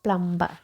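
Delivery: "plumber" with a sounded B; mispronounced plumber. Shown as a demonstration. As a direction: say "plumber" as plum-ba. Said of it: "Okay, not plumber".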